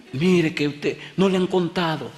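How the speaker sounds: high-pitched, anxious woman in a retelling; alarmed mother. Retold or imitated. Mother warning the pastor about her son, anxious. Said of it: "a la mamá del muchacho".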